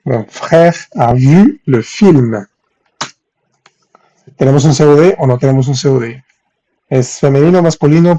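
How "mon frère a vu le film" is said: stretched words, carefully spaced; very slow dictation. Shown as a demonstration. Teacher giving students the next French example, very slowly. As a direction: draw out each word for learners.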